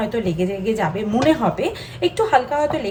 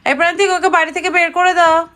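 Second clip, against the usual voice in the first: higher, louder playful call; mock order; 6 semitones higher, 5 dB louder than usual. Mother calling father to send child out, mock order. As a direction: call out a pretend order across the house.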